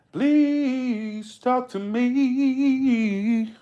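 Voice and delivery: drawn-out, high sing-song begging; mock pleading. Drawn-out mock plea to silent chat.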